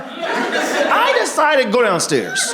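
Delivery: very slow, high, timid confession; sheepish slow excuse. Acted. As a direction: drag it out like a guilty kid. Suicide victim answers God.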